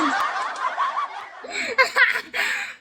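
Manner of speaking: squealing burst of laughter; real high-pitched cackle; high shrieking laugh. Real, not acted.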